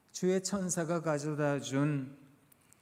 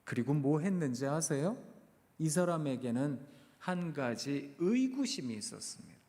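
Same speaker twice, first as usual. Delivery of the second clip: normal talking voice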